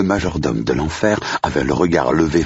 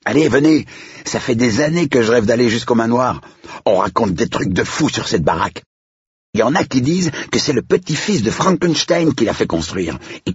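put-on high-pitched, excited kid storyteller; high child voice, egging friends on; eager young boy; 10 semitones higher than usual. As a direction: spread the rumour like a thrilled child. Boy urges the others on.

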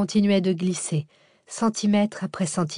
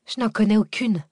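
higher-pitched, piqued retort; vexed grumbling; 4 semitones higher, 3 dB louder than usual. A narrator's reading. Cécile answers the order to chant, vexed. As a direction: push back in annoyance. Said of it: "pesta Cécile vexée".

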